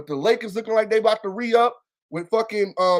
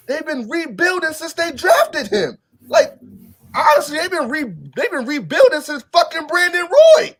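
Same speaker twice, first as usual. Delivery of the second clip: pitched up and louder, fed up; frustrated fan venting about endless rebuilds; exasperated rant; 8 semitones higher, 6 dB louder than usual. Real, not acted. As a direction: rant it in rising disbelief.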